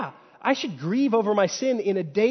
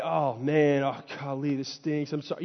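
mock sulking; 4 semitones lower, 4 dB quieter than usual. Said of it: "then I can just kinda sulk".

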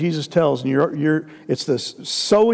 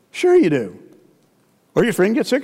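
usual speaking voice

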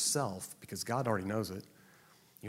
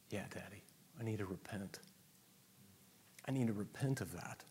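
soft repentance; 4 semitones lower, 8 dB quieter than usual. Acted. Repentant child to Father.

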